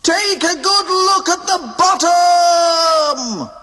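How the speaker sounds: very high, very slow furious shout; raging shout. A narrator's reading. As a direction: scream it as you shove him. Donald still raging at Goofy.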